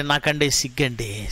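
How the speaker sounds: soft, slow bashful mumble; mock shy excuse. Pastor mimicking a member too shy to speak. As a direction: shrink away while saying it.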